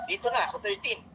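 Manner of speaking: ordinary delivery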